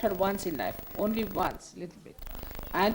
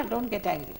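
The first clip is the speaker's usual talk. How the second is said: ordinary delivery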